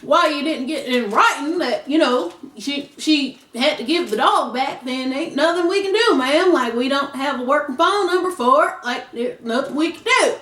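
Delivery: put-on country accent for an official. Police telling a dog owner sorry, country accent. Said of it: "I don't know why I got this country accent on".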